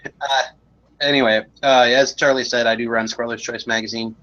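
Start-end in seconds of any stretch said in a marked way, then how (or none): none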